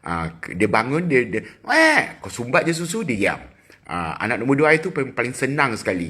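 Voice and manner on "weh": put-on sudden high, loud baby wail; mock baby cry. Parent imitating the baby waking for milk, mock crying. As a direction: let out a short squawk like a hungry baby. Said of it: "Kalau dia nangis, dia nak susu".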